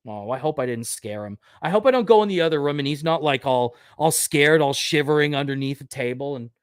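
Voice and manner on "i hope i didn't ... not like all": ordinary delivery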